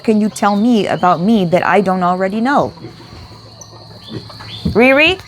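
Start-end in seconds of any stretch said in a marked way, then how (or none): 4.7-5.2 high, loud rising call; calling the dog. Real, not acted. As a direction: call the dog's name sharply.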